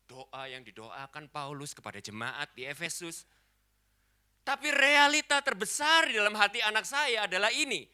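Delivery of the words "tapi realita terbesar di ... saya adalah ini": high, loud emphatic declarations; full-force preaching. Real, not acted. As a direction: cry out each phrase to the congregation.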